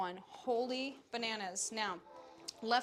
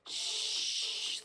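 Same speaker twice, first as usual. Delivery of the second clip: rhythmic shushing; 12 semitones higher than usual. Shown as a demonstration. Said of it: "loud shish".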